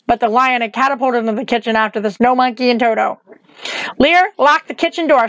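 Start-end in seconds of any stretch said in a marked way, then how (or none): 4.0-5.3 raised, higher-pitched command; mild shout. A narrator's reading. Witch orders Lear to lock the kitchen, mildly shouted. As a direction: call out an urgent order, without full volume. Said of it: "shouted the witch".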